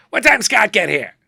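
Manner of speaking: loud, high imitation of a famous comedian; high-pitched mimicked holler; Joan Rivers. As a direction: call out brashly in her unmistakable voice. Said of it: "this unmistakable voice going".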